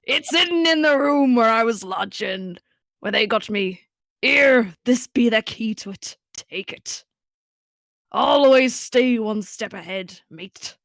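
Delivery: slow, hammed-up pirate; dying pirate, drawn out. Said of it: "And I come back to pirate voice?".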